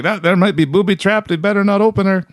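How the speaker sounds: high, folksy Maritime voice; a cautious East Coast old-timer; East Coast accent. Retold or imitated. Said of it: "he's like".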